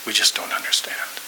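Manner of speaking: soft whispered repeat, breath only. Retold or imitated. Pastor consoling a crying friend, whispering. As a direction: whisper it close to his ear.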